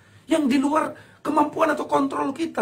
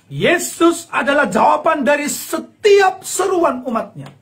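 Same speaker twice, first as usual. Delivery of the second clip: slow, higher, louder proclamation; slow ringing declaration; preaching at full force; 3 semitones higher, 5 dB louder than usual. Real, not acted. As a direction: proclaim it from the pulpit. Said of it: "preaching proclamation".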